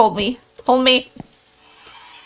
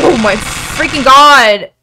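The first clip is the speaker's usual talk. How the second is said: 1 shocked outburst; 9 dB louder than usual. Real, not acted. Reacting to the scare.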